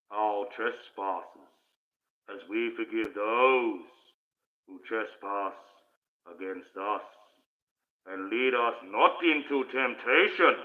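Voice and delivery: loud, very slow, ominous prayer voice; ominous put-on accent voice. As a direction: intone it like a menacing movie villain. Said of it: "I put on a bit of an accent".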